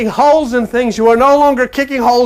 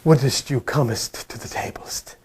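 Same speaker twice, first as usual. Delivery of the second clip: whispered, slow, mock-courtly gentleman; a prissy olde-English butler asking politely; hushed, slow mock archaic invitation; 10 semitones lower, 10 dB quieter than usual. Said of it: "no gentleman in there saying".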